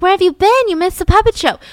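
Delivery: high, loud where-were-you call; loud questioning call. Retold or imitated. Church ladies loudly asking Candy where she was. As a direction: call out to her across the room.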